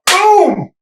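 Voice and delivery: sudden high, loud boom for the slap; slap sound boom. Retold or imitated. Retelling the slap on set, a loud boom. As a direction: burst out with the impact.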